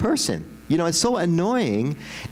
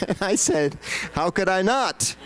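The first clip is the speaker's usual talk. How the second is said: laughing; 5 semitones higher than usual. Real, not acted. Laughing at being caught listening.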